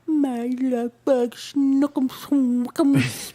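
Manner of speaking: slow, put-on sweetheart voice; silly pet-name voice. Wife teases husband with pet names, slow and silly.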